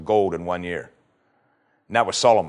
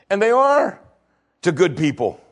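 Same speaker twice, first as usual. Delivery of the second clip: preaching loud; 7 semitones higher, 3 dB louder than usual. Real, not acted. Preacher building to a climax.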